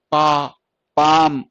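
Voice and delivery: demonstrating a long-vowel word; long aa in palm. Shown as a demonstration. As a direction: stretch the आ of पाम. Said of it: "वो जा रहे थे आ।".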